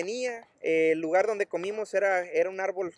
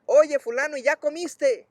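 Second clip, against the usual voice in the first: high, raised calling voice; hollered friendly question, pitched up; 7 semitones higher, 4 dB louder than usual. Retold or imitated. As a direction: call a question out to a passer-by. Woman calls to passing drunk.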